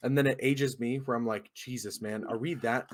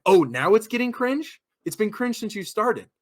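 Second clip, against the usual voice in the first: loud, raised, incredulous comeback; acted indignant retort; 8 semitones higher, 7 dB louder than usual. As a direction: throw their word back at them, outraged. Indignant retort to teens, retold.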